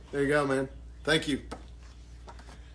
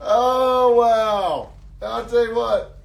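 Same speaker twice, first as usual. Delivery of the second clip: excited; 7 semitones higher, 7 dB louder, much slower than usual. Real, not acted. Reacting after laughing fit.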